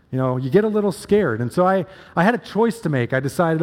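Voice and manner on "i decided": normal talking voice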